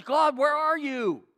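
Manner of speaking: high, loud, slow cry to God; drawn-out desperate plea; anguished call. Acted. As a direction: demand an answer from heaven. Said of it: "What he's saying is".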